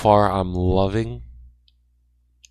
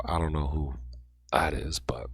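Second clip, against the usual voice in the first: losing voice; 4 semitones lower, 7 dB quieter than usual. Real, not acted. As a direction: voice cutting out to a breathy whisper. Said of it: "sorry, I was losing my voice".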